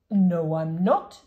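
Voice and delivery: put-on old man insisting he is not deaf; firm denial. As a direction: draw it out, offended. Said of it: "said the saucepan man".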